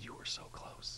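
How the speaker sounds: very soft, slow whisper; hushed, emotional whisper. Retold or imitated. As Jesus to hidden Nicodemus, a hushed whisper. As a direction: whisper it slowly, barely voiced.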